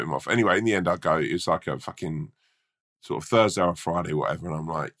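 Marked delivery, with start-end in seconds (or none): none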